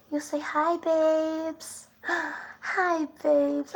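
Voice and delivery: high cooing pet voice with a gasp. Real, not acted. Baby talk to the dog.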